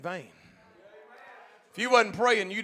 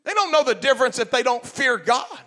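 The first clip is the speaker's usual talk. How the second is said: loud, high preacher's shout; full-force preaching; 8 semitones higher, 12 dB louder than usual. Acted. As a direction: preach it out to the whole congregation.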